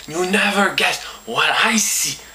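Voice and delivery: normal talking voice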